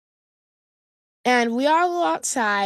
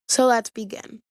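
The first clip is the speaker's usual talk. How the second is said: usual speaking voice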